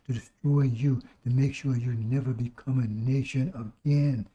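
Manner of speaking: usual speaking voice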